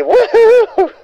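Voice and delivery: high-pitched imitated whoop; mock cheer. Imitating a fan leaping up to cheer. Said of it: "He probably jumped up and say".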